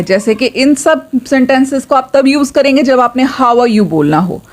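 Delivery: normal talking voice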